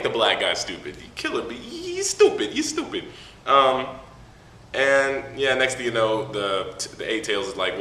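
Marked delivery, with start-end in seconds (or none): 1.1-3.0 very high squeaky complaint; mock exasperation. Real, not acted. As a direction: whine it in an absurdly high voice. Mocking the character's stupidity.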